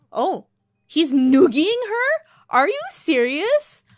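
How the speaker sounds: high, slow, drawn-out gasp of disbelief; really can't believe what he's doing to her; shocked disbelief. Real, not acted. Shocked at the game's characters.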